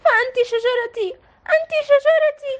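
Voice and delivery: rising, high tearful cry; cling to the tree, pleading in tears; tearful plea. A narrator's reading. Sara hugging the tree, tearful plea. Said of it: "وأخذت تبكي وتقول".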